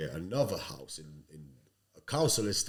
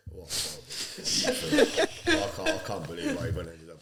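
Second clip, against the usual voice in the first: laughing; 6 semitones higher, 4 dB louder than usual. Real, not acted. Laughing at himself to the podcast hosts about Star Trek.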